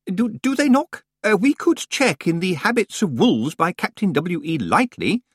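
high, hesitant, distracted old librarian; Senior Librarian Swinsley. A narrator's reading. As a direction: mumble it while rummaging, fluttery and high.

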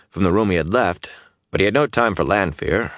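plain reading voice

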